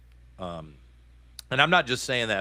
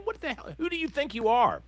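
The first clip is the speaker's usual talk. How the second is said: outraged, much higher pitch; 10 semitones higher than usual. Real, not acted. Speaking to the guard shoving a reporter, outraged and much higher. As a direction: demand it of the guard, incredulous.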